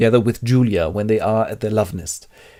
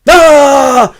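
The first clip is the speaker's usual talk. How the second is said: high loud cry of pain; pain scream; 14 dB louder than usual. Acted. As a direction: yelp as the shock hits. Tortured by device.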